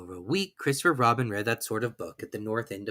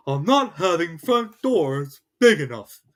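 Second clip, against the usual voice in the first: cross Pooh; 5 semitones higher, 7 dB louder, slower than usual. A narrator's reading. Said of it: "said Pooh crossly".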